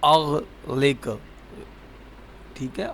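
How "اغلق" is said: اغلق stretched very slow, every sound held; slowly drawn out. Shown as a demonstration. Teacher spelling out a new word for the child, very slow. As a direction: draw out اغلق syllable by syllable. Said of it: "teacher drills the word".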